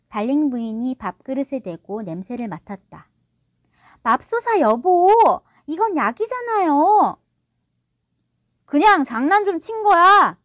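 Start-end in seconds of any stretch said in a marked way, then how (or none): 4.0-7.2 high, loud, drawn-out exclamation; shocked wife. A narrator's reading. Wife finding medicine in the dog's bowl, shocked. 8.7-10.4 high, loud defensive outburst; shouting. A narrator's reading. Father excusing his prank to his wife, shouting. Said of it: "달링 씨가 소리 질렀다".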